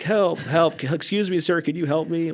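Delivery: high, loud pleading calls; retold old man calling out to passers-by; hammed-up cries to strangers; mock yelling for help. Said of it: "literally yelling to people walking by".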